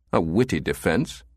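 ordinary delivery, nothing marked

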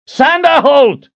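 high, loud, slow call. A narrator's reading. Richard calls out for the head cook, loud. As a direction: call down the kitchen. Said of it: "he called out".

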